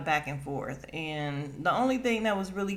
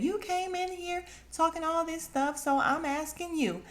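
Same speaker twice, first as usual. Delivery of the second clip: high put-on voice of a fake-mad woman; high mocking imitation of Robin acting mad; mock fake anger; 11 semitones higher than usual. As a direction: ham up someone pretending to be angry.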